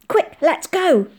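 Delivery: high, loud, drawn-out urging; urgent urging. A narrator's reading. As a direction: hurry them along excitedly. Boy urging friends to hurry away.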